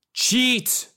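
high, loud screamed accusation; furious shout. Acted. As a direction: scream it at her. Man lunges shouting.

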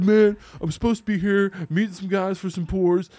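a soldier complaining loudly at the bar; exasperated. Retold or imitated. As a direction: whine that there's no password. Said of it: "He's like".

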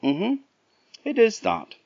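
high wee bird voice; a knowing little hum; robin agreeing. Acted. Robin agreeing with Jack, high wee voice. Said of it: "said the robin".

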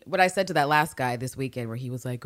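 usual speaking voice

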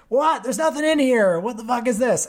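outraged surprise. Retold or imitated. Homeless guy finds briefcase empty.

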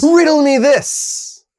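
high, loud, drawn-out catchphrase; playful villainous quizmaster; hammed-up riddle master. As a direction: announce a riddle with theatrical relish. Playful Riddler catchphrase before quiz questions.

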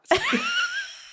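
a sudden, high delighted laugh; high burst of laughter. Real, not acted. Joyful laugh at ending.

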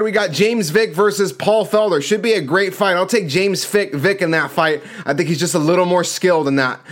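ordinary talk

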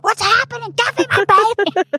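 high, urgent plea; frantic demand. Retold or imitated. Mother in surgery demanding her newborn, high and urgent. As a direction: demand the baby back.

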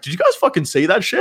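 high, excited mock disbelief; retelling how he rushed back to his mates, excited; retold excitement. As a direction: burst in on your friends with it. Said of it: "And I was like".

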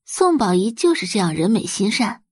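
ordinary delivery

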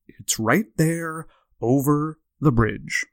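slow, deep, over-enunciated sleepy voice; lightly voiced sleepwalker giving directions; [name]'s sleep-talking voice. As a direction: drone it out like a man talking in his sleep. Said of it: "he has a really distinct, deep voice and has very good diction".

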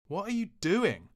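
high, loud outraged yell. Retold or imitated. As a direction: yell it at him in shock. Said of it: "I yelled".

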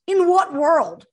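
high, loud disbelief; in what world, really?; incredulous. Real, not acted. Friend mocking the casting, loud incredulous disbelief.

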